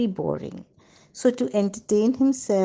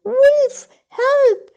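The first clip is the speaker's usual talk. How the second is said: very high, very loud screams for help; terrified scream; 8 dB louder, much slower than usual. Acted. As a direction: scream for your life as the wolf comes. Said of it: "he did manage to scream".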